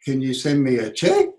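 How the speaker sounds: a questioning check; stress on check. Shown as a demonstration. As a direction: check lifted with a rising question tune. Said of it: "intonation patterns".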